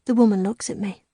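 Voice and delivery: usual speaking voice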